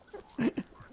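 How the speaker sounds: a high-pitched burst of laughter; high giggle. Real, not acted. Gamer cracking up at his own Mason joke, high giggle.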